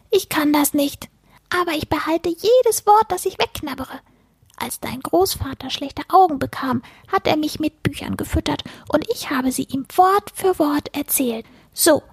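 high-pitched little creature recounting; furry book-eater. A narrator's reading. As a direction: explain yourself as a squeaky furball. Creature tells its history.

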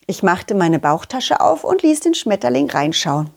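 usual speaking voice

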